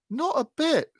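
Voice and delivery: put-on high, loud ragged boy's voice; Jo, a high street urchin voice. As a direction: play the poor crossing-sweeper boy, insistent. Jo denies it.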